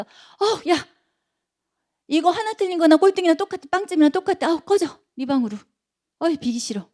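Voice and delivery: high, loud, fast yelling parent; mock furious parent. Father furiously scolding child over one wrong answer, shouting high. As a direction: yell at the kid like an enraged dad. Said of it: "불같이 화를 내면서".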